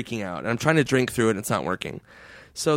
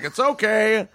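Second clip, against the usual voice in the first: high, forgiving mock guard; put-on guard voice, pitched up; old security guard; 8 semitones higher, slower than usual. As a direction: imitate a guard letting it go. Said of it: "This old security guard's like".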